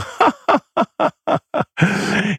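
a high mock-gleeful cackle; high cackling laugh. Shaman sitting back, secretly amused at his family, cackling. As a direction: cackle to yourself over a secret. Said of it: "and laugh and just go".

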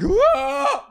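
very high goat yell; imitated goat scream. Man demonstrating the hilarious goat's scream, high-pitched yell. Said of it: "when it screams, it goes".

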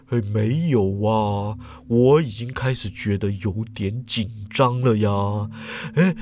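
put-on low, unhurried old professor voice; a nervous old professor talking himself out of it. A narrator's reading. Professor tells the students he'd rather go make tea, low and nervous.

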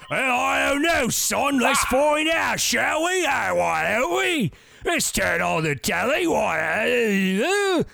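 high, hearty hammed-up TV father; cheery shouting fifties dad; wholesome sitcom dad. Voices sitcom dad answering son.

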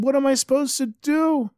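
high-pitched acted cry of helplessness; desperate plea. Fivish turns to his sons-in-law for help, high and desperate.

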